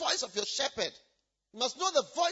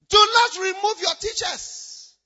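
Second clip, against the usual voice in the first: slow, louder preacher's order; loud command; 7 semitones higher, 9 dB louder, slower than usual. Acted. Preacher warning the church not to sideline teachers, loud slow command. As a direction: lay down the law to the church.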